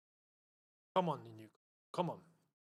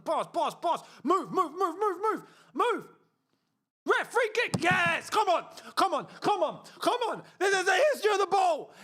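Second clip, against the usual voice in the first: loud, high cheering; excited shouting; 9 semitones higher, 3 dB louder than usual. Real, not acted. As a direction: cheer the free kick. Free kick awarded, cheering.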